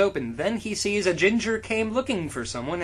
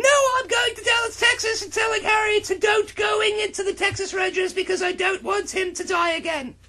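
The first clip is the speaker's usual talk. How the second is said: shrill Ginny; 14 semitones higher than usual. Acted. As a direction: yell it high and fast as a frantic girl. Ginny voiced, high fast shout.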